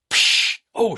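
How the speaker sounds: voiceless hiss of brake air, then a gasped oh. Retold or imitated. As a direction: hiss the air out, breathy and high. Brake air noise then exclamation.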